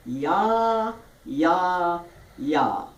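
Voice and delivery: each "yah" held long and open; jaw-opening drill. Shown as a demonstration. Teacher demonstrating a jaw exercise, "yah" open and falling in pitch. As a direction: step "yah" downward in three sustained beats. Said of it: "for the jaw opening".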